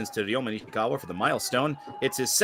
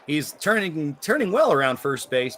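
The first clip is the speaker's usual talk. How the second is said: excited call; 4 semitones higher, 4 dB louder than usual. Real, not acted. Play-by-play of a base hit.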